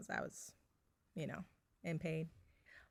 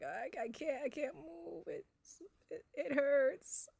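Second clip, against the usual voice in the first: high, strained, tearful plea; retold pained crying; 11 semitones higher, slower than usual. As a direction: squeeze it out through tears of pain. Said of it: "And then I was like".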